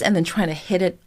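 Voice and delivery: ordinary talk, nothing marked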